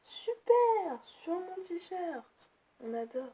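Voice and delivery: mock "super", very high then dragged out; sarcastique. Real, not acted. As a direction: fake enthusiasm after a mishap. Produit renversé sur son t-shirt. (ravie pour de faux, très aiguë puis traînante)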